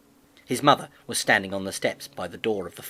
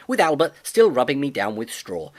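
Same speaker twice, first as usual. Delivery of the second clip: usual speaking voice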